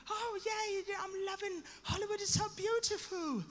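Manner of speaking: high, soft English lady voice; young English lady. Acted. English lady at Hollywood auditions, gushing young English lady. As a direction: gush softly in an English accent. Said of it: "this young English lady comes".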